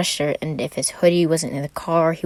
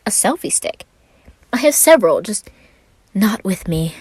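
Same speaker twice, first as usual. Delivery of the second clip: lightly voiced Roman; 5 semitones higher, 5 dB louder than usual.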